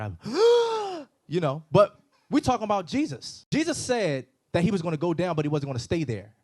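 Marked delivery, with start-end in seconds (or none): 0.2-1.0 big, high, loud mock gasp; acted sharp intake of astonishment; amazed gasp. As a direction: gasp loudly in exaggerated amazement. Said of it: "I would have been amazed".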